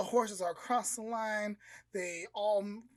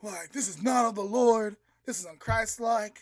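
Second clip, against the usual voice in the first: loud, higher protest repeated; loud indignant dad; outraged religious dad; 3 semitones higher, 9 dB louder than usual. Acted. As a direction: forbid it like a scandalized father. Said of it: "the dad's like".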